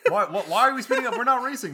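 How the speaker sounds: high, loud, laughing imitation of a wife; alarmed wife. Wife asking husband why they speed, high mock alarm. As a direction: protest in a high mock panic.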